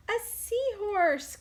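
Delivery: very high and loud delighted cry; joyful cry. Acted. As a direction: shout with delight at the find. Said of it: "cries Pete".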